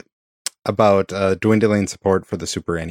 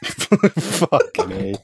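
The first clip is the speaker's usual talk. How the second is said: laughing; 9 semitones higher, much slower than usual. Real, not acted. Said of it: "laughing at own failure".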